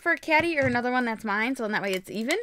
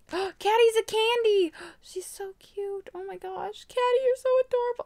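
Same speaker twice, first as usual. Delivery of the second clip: high, swooning delight; adoring gasp; 8 semitones higher than usual. Real, not acted. Gushes over favourite figure.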